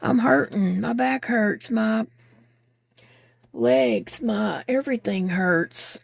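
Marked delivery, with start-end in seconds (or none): none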